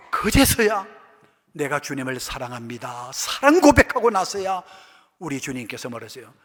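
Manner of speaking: ordinary talk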